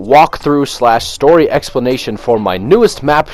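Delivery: loud, high, upbeat channel greeting; YouTube intro. Acted. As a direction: hype up the welcome.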